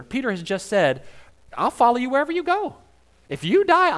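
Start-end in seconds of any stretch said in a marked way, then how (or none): 1.6-4.0 high, loud, earnest promise; eager vow. Acted. Peter swearing to Jesus, high and eager. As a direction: Peter pleading his loyalty, pitched up.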